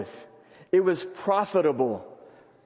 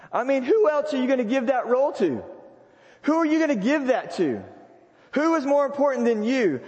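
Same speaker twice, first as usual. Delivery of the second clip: emphatic challenge; 6 semitones higher, faster than usual. Real, not acted. Rising challenge, higher pitch.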